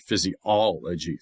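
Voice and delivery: physiology slowly, OL drawn out; stress on third syllable. Shown as a demonstration. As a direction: lean hard on the OL in "physiology". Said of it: "the third".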